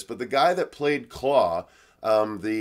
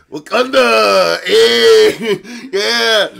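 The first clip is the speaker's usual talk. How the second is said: high, drawn-out hammed-up shout; loud, slow joking cheer; mock battle cry; 8 semitones higher, 12 dB louder, much slower than usual. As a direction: holler it like a mock war chant. Mock battle cry amid laughter.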